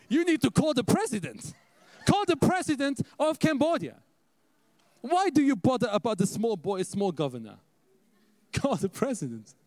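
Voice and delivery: high, loud imitated Ghanaian English; Ghanaian accent. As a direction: exclaim like an amused Ghanaian mentor. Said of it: "so he speaks a little different".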